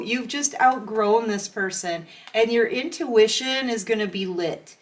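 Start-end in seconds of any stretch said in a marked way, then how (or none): none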